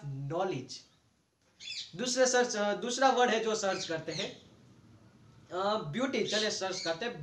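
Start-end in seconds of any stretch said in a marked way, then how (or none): 0.0-0.8 नॉलेज said low with a silent k; pronouncing knowledge. Shown as a demonstration. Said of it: "क्या हो जाएगा?". (नॉलेज का सही उच्चारण, नीचे स्वर में)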